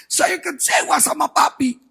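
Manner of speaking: mock-tearful, high-pitched plea; tearful retelling. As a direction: imitate a hurt child pleading with dad. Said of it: "dia nangis".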